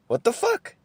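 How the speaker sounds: squeaky high disbelieving what the fuck; exasperated. Real, not acted.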